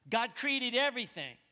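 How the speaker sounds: high, loud pulpit declaration; loud proclamation. Real, not acted. Preacher proclaiming creation to the congregation, loudly. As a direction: proclaim it with full force.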